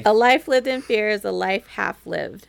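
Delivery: firm finished recitation; confident recitation. Shown as a demonstration. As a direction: say it firmly, getting it right. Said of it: "There we go".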